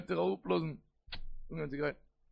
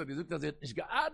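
ordinary talk

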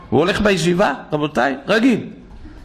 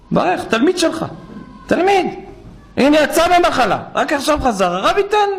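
high, urgent pleading; pleading plea; 10 semitones higher than usual. Retold or imitated. Rabbi begging his colleague to bless a sick student, high urgent pleading. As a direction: beg the rabbi insistently, voice climbing.

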